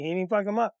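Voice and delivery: ordinary talk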